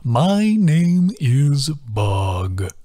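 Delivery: extremely slow, every word drawn out; very slow creature introduction; stately sing-song. A narrator's reading. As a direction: present yourself proudly.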